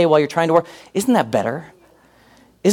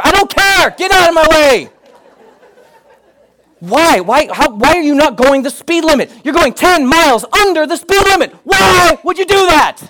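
much higher, louder hammed-up yelling; mock road rage shout; 11 semitones higher, 6 dB louder than usual. Pastor venting at a driver under the speed limit, mock shouting.

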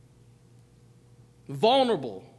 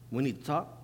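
lower, softer "We need to talk", level and plain; low flat reading; 8 semitones lower, 8 dB quieter than usual. Retold or imitated. Reading a text message, low calm tone. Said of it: "discerning the voice".

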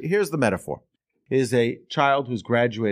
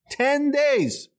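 much higher, incredulous squeak; dismayed disbelief. Retold or imitated. Nephew hearing he must wait ten days, incredulous. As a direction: sound crushed that it takes so long.

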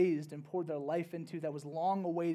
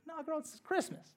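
put-on much higher, playful "no, girl" brush-off; sassy retort; 12 semitones higher than usual. Telling Andy it's Christmas, playful sassy brush-off. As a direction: toss it off like teasing a friend. Retelling her reply to Andy.

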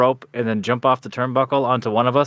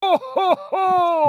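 crowd call; much slower than usual. Acted. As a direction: shout it to the arena. Rallying crowd call again.

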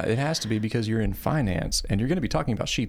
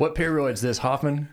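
higher pitched mock coach asking a question; imitating the coach; 7 semitones higher than usual. Retelling the biology coach's question, higher mock coach voice. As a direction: ham up the old coach quizzing a student. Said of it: "he called it seventh peroid".

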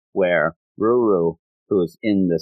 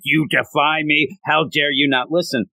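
higher, hammed-up outrage; mock anger; 6 semitones higher than usual. Magu-chan getting mad at a ringing phone, mock-angry. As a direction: play at being an offended overlord. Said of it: "starts really getting mad".

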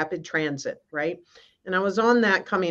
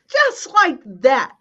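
ordinary delivery

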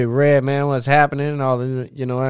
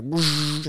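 whoosh sound; 4 semitones higher than usual. Retold or imitated. Imitating gravel kicked by burnout.

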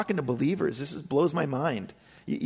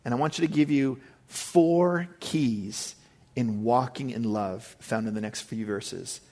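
usual speaking voice

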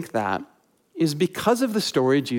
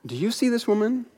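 higher, softer, searching question; Jesus questioning host; 8 semitones higher, 4 dB quieter than usual. Retold or imitated. Jesus turning from his host Simon to ask him, higher and softer. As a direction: lift the question so the host must look at her.

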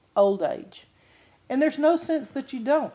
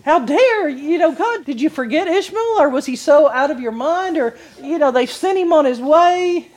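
mock indignation; 5 semitones higher, 7 dB louder than usual. Mock outrage at God over Ishmael.

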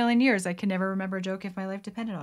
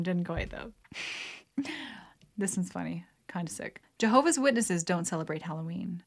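ordinary talk, nothing marked

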